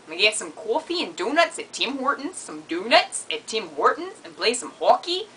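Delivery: hammed-up Canadian with rising endings; lilting mock Canadian accent. Shown as a demonstration. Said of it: "I also know how to say Tim Hortons".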